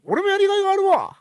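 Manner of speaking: very high, strained mimic voice; 川藤 impression. Co-host answers praise of his mimicry in character, high impression voice. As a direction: retort in a squeezed, high comic impersonation. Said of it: "モノマネを初めて評価されましたね".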